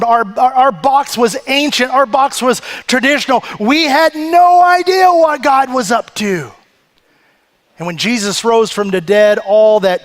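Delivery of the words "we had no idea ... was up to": preaching shout. Real, not acted. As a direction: belt it out to the back row. Preacher builds to climax.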